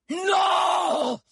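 high, drawn-out shout of no; desperate cry. Acted. Wounded Eugénor screams as she aims for his heart. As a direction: scream as the horn strikes. Stag lord cries out in terror.

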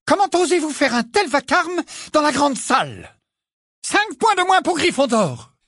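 high, loud teacher's reprimand; outraged scolding. A narrator's reading. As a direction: scold a noisy pupil at full voice. Teacher scolds pupil for racket.

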